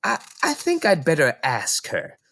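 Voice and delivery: concerned librarian thinking aloud; librarian Mrs Phelps. Acted. Mrs Phelps worrying about Matilda, hesitant librarian voice.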